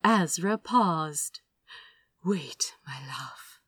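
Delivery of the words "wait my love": whispered into an ear; soft murmur held back with restraint. Acted. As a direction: hush it close and intimate. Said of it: "he murmured in his ear".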